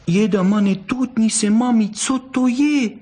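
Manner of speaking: very high little fox-cub voice; high-pitched childish wonder; babbling little child. A narrator's reading. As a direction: babble like a curious toddler. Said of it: "zažvatlala".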